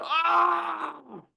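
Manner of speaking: long, high-pitched "aah" of exasperation; exasperated cry. Acted. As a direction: let out a sustained high wail, acted. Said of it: "like you go".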